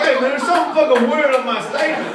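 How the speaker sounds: high, loud, laughing phone complaint; loud mock complaint. Comic tells the bank about his statement, loud and high. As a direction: yell it up the line at the bank. Calling the bank, acted quote.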